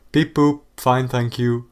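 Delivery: beeping like a robot; fake robot voice. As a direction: play the dumb robot. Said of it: "better keep the act up".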